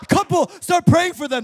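high, loud imitated altar-call order; preacher's command. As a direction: call it out across the auditorium. Voices preacher commanding the couple.

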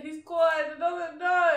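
high, loud cry of no; hearing of dad's death, screaming no; anguished scream. Retold or imitated. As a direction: scream no in disbelief. Mum says dad gone, she screams.

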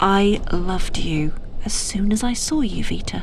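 whispered love confession; tender whisper. Acted.

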